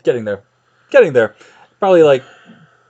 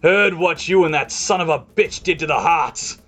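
barely contained orcish rage; raised, seething accusation from a half-orc guard; snarling half-orc; 5 semitones higher than usual. Acted. Half-orc guard accusing a traitor, barely contained rage. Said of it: "barely contained orcish furnace of rage".

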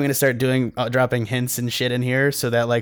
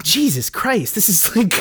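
retold shock; 8 semitones higher, slower than usual. Acting out shock at weird subreddit.

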